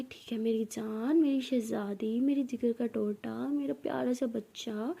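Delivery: slow, soft, syrupy endearments; loving. Acted. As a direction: coax her tenderly, drawing the words out. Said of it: "प्यार से बोल रही हूँ".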